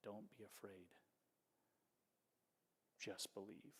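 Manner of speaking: soft hushed near-whisper; hushed reassurance, barely voiced. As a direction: breathe it gently like a secret comfort. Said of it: "Jesus whispers to him".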